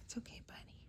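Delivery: soft, high, hushed whisper; cooing to a scared pet. Real, not acted. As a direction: whisper gently to a nervous cat. Whispering to the calm cat.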